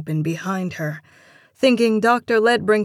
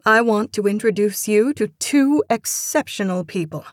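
louder, higher announcement to a team; announcing; 4 semitones higher, 5 dB louder than usual. A narrator's reading. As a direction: introduce guests to the whole team. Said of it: "Doctor Ledbrinker announced".

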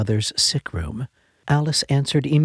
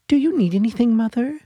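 put-on high child voice, a touch louder; eager little girl; 12 semitones higher, 4 dB louder, faster than usual. A narrator's reading. Little girl jumping on her sick mother's bed, high eager voice. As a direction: bounce in like an excited young daughter.

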